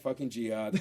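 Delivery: usual speaking voice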